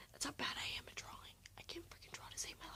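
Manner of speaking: breathy whisper to the camera; whispered aside. Real, not acted. Vlogger admitting to viewers how bad her drawing is, whispered. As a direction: confide it in a whisper. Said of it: "whispered aside to viewers".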